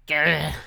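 short, loud mock growl; frustrated growl. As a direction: growl in exasperation. Acting out frustration at wrecks.

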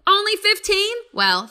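ordinary talk

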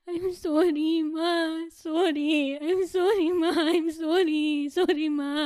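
choked-up, pleading sorry to her mother; tearful apology. Acted. Daughter begging her mother's forgiveness, tearful. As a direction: apologize through held-back tears.

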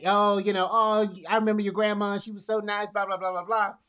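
high, lively run of comforting chatter; chatty encouragement. Pretend. Comforter reminiscing to a grieving person, chatty encouragement. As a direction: gush along, tailing into blahs. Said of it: "that encouragement".

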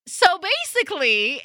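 ordinary delivery

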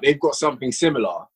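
usual speaking voice

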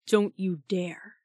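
slow, breath-heavy rumble; rumbled warning. A narrator's reading. Shark warning a spirit away from Jazz, rumbling. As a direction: warn them off slowly, under the breath. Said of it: "he rumbled to Carmilla".

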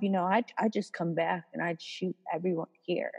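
imitating a coworker's offhand, low-key menace; casual threat. Dorky guy retelling his shooting threat to supervisors, casually. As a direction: toss it off softly, as if harmless. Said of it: "He just says that casually".

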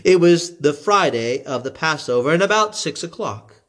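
ordinary delivery, nothing marked

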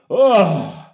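loud, high mock grunt of effort; straining groan. Gym-goer drops the dumbbells he tried lifting, loud straining groan. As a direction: groan like the weight is far too heavy.

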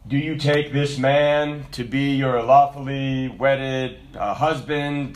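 slow, low, formal officiant voice; wedding officiant. Retold or imitated. Officiant asking a bride for her vow, slow and solemn. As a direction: intone the vow question slowly and ceremonially. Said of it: "you ever been to a wedding?".

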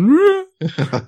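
high wordless whoop; high-pitched drawn-out cry, imitated; imitated driver's holler. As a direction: mimic lads hollering out of a car window. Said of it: "make sure that I can hear this".

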